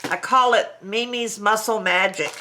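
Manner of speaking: normal talking voice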